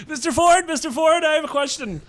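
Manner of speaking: high, loud, squeaky pleading voice; eager mock questioner. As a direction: pester a star in a silly high-pitched voice. Acting out eager question to Ford.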